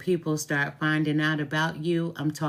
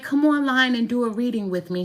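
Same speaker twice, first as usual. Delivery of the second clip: coaxing plea; 7 semitones higher, 3 dB louder, faster than usual. Retold or imitated. Mother pleading with daughter.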